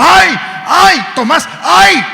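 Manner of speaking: high, loud panicky cries; mock wailing. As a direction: wail in hammed-up panic. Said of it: "Así es como nos agarra la llorona".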